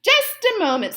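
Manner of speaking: high, loud call. A narrator's reading. Mouse dentist calling down to the fox.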